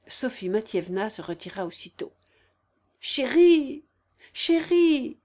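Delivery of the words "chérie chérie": high, loud, gasped appeal; panting voice. A narrator's reading. Sick man calls to his darling, panting voice. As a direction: call to her as if short of breath. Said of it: "fit-il d'une voix haletante".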